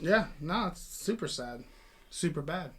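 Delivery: low, soft dry wordplay; mock-sad, understated deadpan; deadpan sarcasm. Real, not acted. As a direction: drop the pun quietly and dryly. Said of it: "come on, sarcasm guy".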